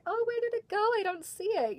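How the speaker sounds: very high-pitched feigned puzzlement; acting all surprised. Belle pretends she cannot find the kids' basketball, in high mock surprise. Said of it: "acting all surprised".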